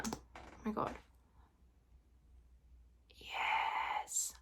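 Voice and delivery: whispered in awe, barely audible; breathless whisper. Real, not acted. Vlogger whispering in awe over a gloss, hushed. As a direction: hush it like a secret delight.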